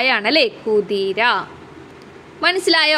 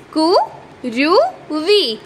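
കു, രു, വി drawn out very slowly, one syllable at a time; 3 semitones higher, much slower than usual. Shown as a demonstration. Teacher sounding out കുരുവി slowly for small children. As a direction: stretch each syllable of കുരുവി for the class. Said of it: "ക എന്ന അക്ഷരത്തിനും രാ എന്ന അക്ഷരത്തിനും എന്തുണ്ട്?".

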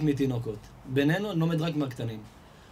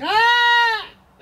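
loud mock wail of a hungry baby; imitated baby cry; 10 dB louder, faster than usual. Preacher shows how a hungry child calls out, imitated crying. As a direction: cry out in bursts like a screaming infant. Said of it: "הוא צועק".

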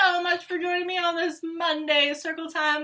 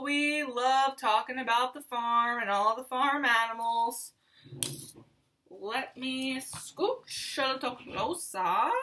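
ordinary talk, nothing marked

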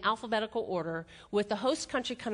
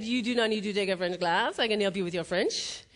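imitated Frenchman's English; French accent; 3 semitones lower than usual. Frenchman offering to help with her French, French accent. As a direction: put on his French accent. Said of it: "he has this French accent".

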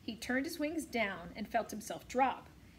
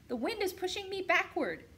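gosling voice; 10 semitones higher, 4 dB louder than usual. A narrator's reading. Gosling voice in story.

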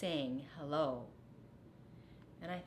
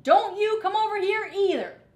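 much higher, much louder shout; 13 semitones higher, 10 dB louder than usual. A narrator's reading. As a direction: yell it back defiantly. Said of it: "shouted Rat".

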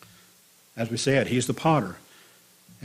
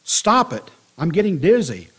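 raised, louder plea, starting high; mild storytelling scream; 5 semitones higher, 5 dB louder than usual. A narrator's reading. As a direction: cry out in protest without a full scream. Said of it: "I screamed".

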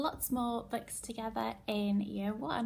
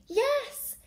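loud, high, jubilant yes; excited cheer; 11 semitones higher, 7 dB louder than usual. Acted. Teacher celebrating the monkeys coming to help, excited cheer. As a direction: cheer as rescue arrives.

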